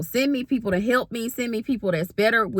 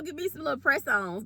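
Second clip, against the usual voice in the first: normal talking voice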